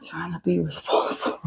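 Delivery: whispering. Real, not acted. Whispered aside.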